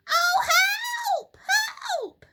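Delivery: very high, loud shriek; scream for help. A narrator's reading. Missus Twit upside down, high loud screaming for help. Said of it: "screamed Missus Twit".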